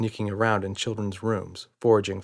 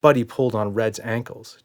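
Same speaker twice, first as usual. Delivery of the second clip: plain reading voice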